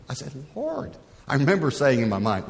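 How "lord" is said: high startled plea. Retold or imitated. Protesting to God.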